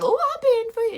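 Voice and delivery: high, eager flirty voice; floozy brush. Acted. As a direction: offer yourself coyly in a high voice. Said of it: "she's like".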